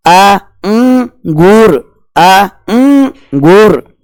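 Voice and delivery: आ, उम, गुर drawn out with उम hummed through the nose; very slow syllable demo. Shown as a demonstration. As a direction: drag out आ, उम, गुर and hum the उम. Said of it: "अनुस्वार का उच्चारण केवल और केवल किससे". (आ, उम, गुर को बहुत धीरे खींचकर बोलना)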